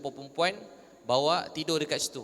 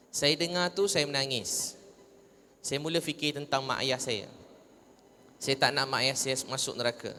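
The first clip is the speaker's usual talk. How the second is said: ordinary talk, nothing marked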